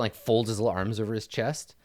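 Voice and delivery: usual speaking voice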